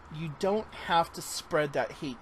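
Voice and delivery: ordinary delivery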